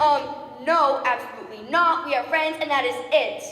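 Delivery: loud insistent refusal; emphatic refusal. Acted. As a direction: refuse loudly and firmly. Flatly refusing marriage.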